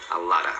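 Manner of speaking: "lotta" ending in a plain uh; low-pitched "a lotta", "of" reduced to uh; reduced form. Shown as a demonstration. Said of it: "como se fosse um a".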